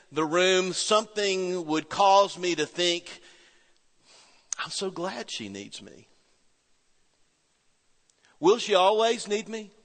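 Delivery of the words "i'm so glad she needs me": tender thought. Retold or imitated.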